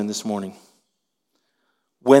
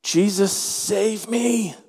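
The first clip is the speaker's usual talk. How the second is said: mild cry out; 7 semitones higher, much slower than usual. Acted. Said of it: "He cried out".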